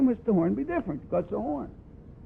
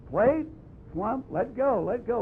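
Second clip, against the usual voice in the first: demonstrated release; 4 semitones higher, slower than usual. Shown as a demonstration. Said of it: "This should always be".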